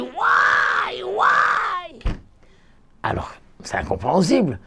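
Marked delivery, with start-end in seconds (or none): 0.0-2.0 much higher, slow why; pained cry. Retold or imitated. Rabbi acting out the damned crying in the snow. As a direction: moan why in anguish. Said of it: "ils disent".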